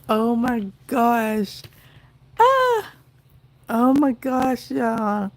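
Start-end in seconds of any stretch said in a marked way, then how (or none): 2.4-2.9 high, loud squeal of delight; joyful squeal. Real, not acted. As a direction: shriek with happiness.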